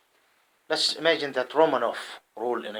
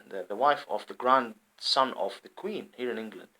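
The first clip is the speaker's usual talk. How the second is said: normal talking voice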